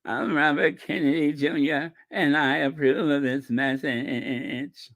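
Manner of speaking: exaggerated tremor, stretched to a wobbling finish; Robert Kennedy Junior. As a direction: imitate a strained, quavering politician. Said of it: "can't wait to see his commercials".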